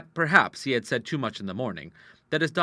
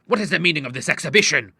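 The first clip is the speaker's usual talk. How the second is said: loud, higher indignant demand; angry; 5 semitones higher, 6 dB louder, faster than usual. A narrator's reading. Father confronts daughters after party, angrily. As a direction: demand an explanation, voice raised. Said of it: "he cried angrily".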